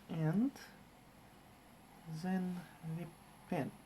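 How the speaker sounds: narrating each step in a hammed-up German accent; mock German accent with z for th; bad German accent. Streamer narrating his Lego build in a mock German accent. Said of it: "In a German accent, apparently".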